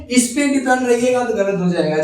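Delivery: normal talking voice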